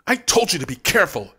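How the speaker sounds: urgent scolding. A narrator's reading. Dina rebuking Brent while rushing to the cabinet, urgent. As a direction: bark it while hurrying.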